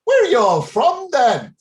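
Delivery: high, loud mock Irish accent; terrible Irish accent. Tea-room woman questioning young tourists, terrible mock Irish accent. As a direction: imitate an Irish barmaid quizzing visitors, hammed up. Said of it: "forgive the accent, I know it's terrible".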